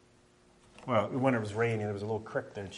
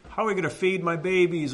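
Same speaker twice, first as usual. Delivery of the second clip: crying out to God; 8 semitones higher, 6 dB louder than usual. Retold or imitated. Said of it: "she's crying out to the Lord".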